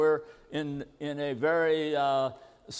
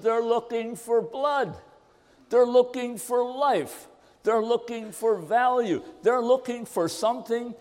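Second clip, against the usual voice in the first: rising appeal; 6 semitones higher than usual. Acted. Preacher builds to urgent appeal.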